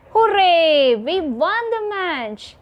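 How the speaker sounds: loud, high "Hooray" with a punched "won"; exclamation, stress on HOORAY and WON. Shown as a demonstration. Teacher demonstrates an exclamation for children, excited stress on "Hooray" and "won". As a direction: cheer it out, voice leaping up on "Hooray" and "won". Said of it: "The word hooray and then the word won".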